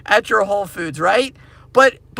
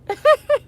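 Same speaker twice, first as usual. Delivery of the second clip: laugh. Real, not acted. Laughing at himself.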